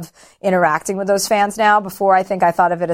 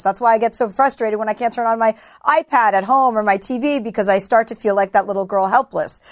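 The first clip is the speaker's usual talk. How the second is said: normal talking voice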